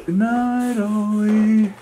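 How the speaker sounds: high, loud sing-song goodnight. Real, not acted. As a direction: coo goodnight to the dog. Saying goodnight to sleeping dog.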